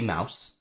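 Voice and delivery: mouse, quiet and clear; stressed syllable. Shown as a demonstration. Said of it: "so it's gotta be".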